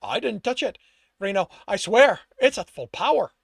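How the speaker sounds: anxious protest. A narrator's reading. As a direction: insist you are innocent, rattled. Underling protests his innocence to boss.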